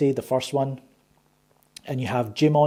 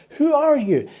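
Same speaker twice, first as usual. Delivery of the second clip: mock film voice; 10 semitones higher than usual. Acting out film's post-credit line.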